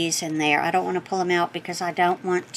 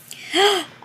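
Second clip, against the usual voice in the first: sharp high gasp of delight; surprised gasp; 11 semitones higher than usual. Real, not acted. Finding the gift, a delighted gasp. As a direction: gasp as if caught off guard.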